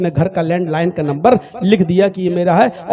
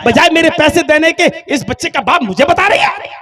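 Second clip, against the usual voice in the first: rising from a sneer into a loud, higher rant with a cry in it; angry scolding; 5 semitones higher, 5 dB louder than usual. Acted. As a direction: scold her harder and harder until you nearly yell. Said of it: "डांटते हुए कहता".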